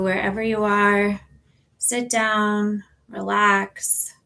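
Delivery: ordinary talk, nothing marked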